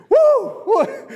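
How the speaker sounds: high, loud delighted whoop; excited whoop. Real, not acted. Preacher whoops at the line, excited. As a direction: let out a thrilled woo.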